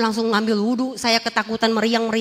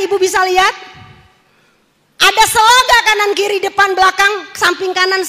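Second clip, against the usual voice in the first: ordinary talk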